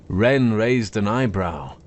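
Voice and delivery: plain reading voice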